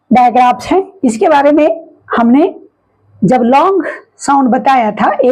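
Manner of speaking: ordinary talk, nothing marked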